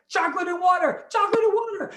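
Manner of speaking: high, loud excited chant; eager high request. Acted.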